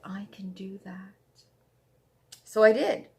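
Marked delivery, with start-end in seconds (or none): 0.0-1.4 a quiet, determined whisper; whispered to herself. Retold or imitated. Stitcher recalling her resolve, whispering. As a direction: breathe it like a private vow. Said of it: "And I said".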